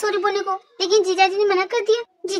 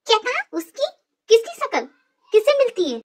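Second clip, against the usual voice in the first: ऊँचे सुर में चौंके सवाल; सुनी बात पर यक़ीन न हो, ऐसे पूछो; हैरानी; 5 semitones higher than usual. Acted. हमशक्ल की बात सुनकर चौंकी. (चौंककर पूछना)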